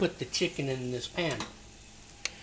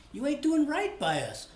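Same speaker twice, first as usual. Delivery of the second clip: higher mock-reproachful friend; Pabs; 10 semitones higher than usual. Friend Pabs chiding the host for ignoring Filipinos, raised mock voice. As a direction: chide him playfully in a raised buddy voice. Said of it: "He says".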